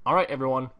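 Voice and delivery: usual speaking voice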